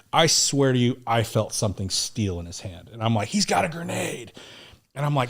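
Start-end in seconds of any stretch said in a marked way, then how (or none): 3.3-4.3 retold alarm. Retold alarm on feeling a grenade.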